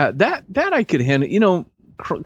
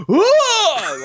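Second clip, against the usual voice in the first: high, loud startled "Whoa!"; startled yell; 4 dB louder than usual. Retold or imitated. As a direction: jump out of your skin on "Whoa!". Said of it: "Like that".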